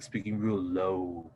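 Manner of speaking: low, soft and drawn out; real low voice. Shown as a demonstration. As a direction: slowly sink your pitch to demonstrate. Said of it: "like, that's how it is".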